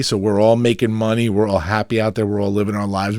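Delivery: ordinary talk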